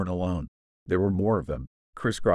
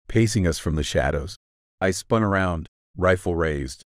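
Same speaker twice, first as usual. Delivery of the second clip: ordinary delivery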